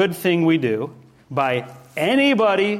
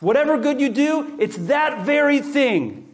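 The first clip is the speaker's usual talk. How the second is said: a hint of God pointing, lifted higher and drawn out; voicing God; 9 semitones higher, slower than usual. Pastor voicing God's words to believers, lightly raised. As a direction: lightly voice the Lord singling out one deed. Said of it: "He's saying".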